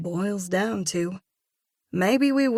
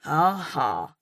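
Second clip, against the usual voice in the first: dry; 2 semitones lower than usual. A narrator's reading. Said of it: "I say dryly".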